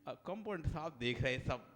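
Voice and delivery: normal talking voice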